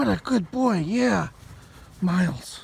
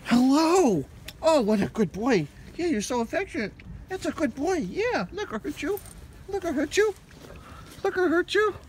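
much higher, softer cooing; 7 semitones higher, 5 dB quieter than usual. Real, not acted. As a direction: sweet-talk the dog gently. Petting the affectionate dog.

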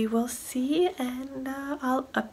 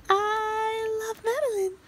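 slow, much higher sing-song croon; 11 semitones higher, slower than usual. Real, not acted. Mom crooning to baby Madeline in high baby talk.

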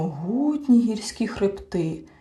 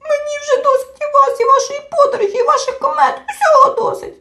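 high, loud tearful wail of a tiny creature; a little creature breaking into sobs; wailing and sobbing; 8 dB louder than usual. A narrator's reading. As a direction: bawl it out, fed up with everything. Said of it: "заголосив маленький чмих і розридався".

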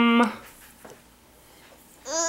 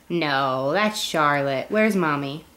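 usual speaking voice

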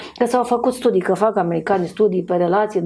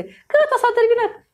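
much higher, sing-song neighbour; mock neighbour voice; 13 semitones higher than usual. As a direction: squeak it out like a triumphant neighbour. Vecina imitată. (vecina care îl ia pe bărbat, imitată cu voce mult mai sus)

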